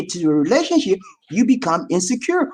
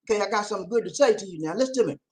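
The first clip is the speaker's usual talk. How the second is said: ordinary talk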